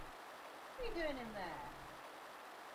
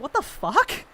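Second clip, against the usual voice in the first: shocked reaction; 7 semitones higher, 5 dB louder, slower than usual. Retold or imitated. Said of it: "I was like".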